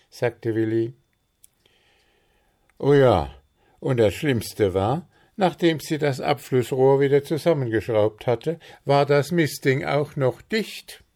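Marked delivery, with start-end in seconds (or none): 2.8-11.0 higher, louder, self-important gnome voice; Puh the gnome. Acted. Puh tells friends about the blocked drain, high and loud. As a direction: boast about his plan, pitched up.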